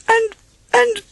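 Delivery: very high, stammering and anxious; backing away while making excuses; nervous Piglet. Acted. Piglet dodging the adventure, high stammering fret.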